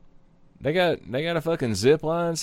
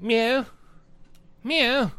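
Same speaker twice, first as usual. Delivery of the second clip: cat meow; 7 semitones higher, 3 dB quieter than usual. Retold or imitated. Imitating the cat.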